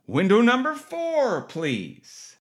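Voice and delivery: high sing-song call of window number, dropping off at please; nurse calling out. Retold or imitated. Triage nurse calling next patient.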